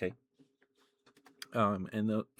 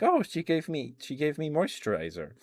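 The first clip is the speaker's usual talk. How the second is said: higher, louder retold realisation; pleasantly surprised; 7 semitones higher, 5 dB louder than usual. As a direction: act out a sudden "oh" of discovery. Acted realisation in retold dream.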